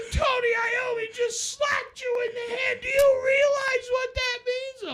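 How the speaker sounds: very high, loud, near-crying shriek; excited fanboy shout. Retold or imitated. [name] shrieking again about [name]'s slap. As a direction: scream it like it's the best day ever. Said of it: "he was like".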